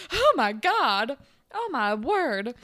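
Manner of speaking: loud, drawn-out gasps of shock; slow, hammed-up horror at the scandal; mock scandalized.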